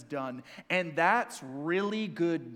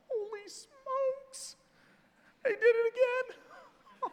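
much higher, slow, exaggerated put-on voice of a fed-up God; exasperated God; much slower than usual. Preacher mocking the wrong view of God, high and slow. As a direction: ham up a weary, exasperated deity. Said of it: "God looks at and goes".

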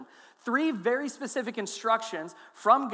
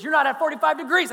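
loud, higher barked correction; drill sergeant correction; 6 semitones higher, 7 dB louder than usual. Retold or imitated. Drill sergeant correcting the recruit's feet, loud bark. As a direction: bark it like a sergeant catching a mistake. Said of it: "treat me like you're a drill sergeant".

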